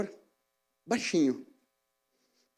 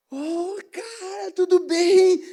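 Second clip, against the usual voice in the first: much higher, slow, then loud joyful greeting; big excitement; 13 semitones higher, 6 dB louder, much slower than usual. Retold or imitated. Claudinho greeting him on the bus, bursting with excitement. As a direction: greet your old friend overjoyed. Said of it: "Com aquela empolgação".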